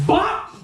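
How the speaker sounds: high, popped "bop"; imitated punch sound. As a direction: make the punch land with your mouth. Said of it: "cocks that bitch back and goes".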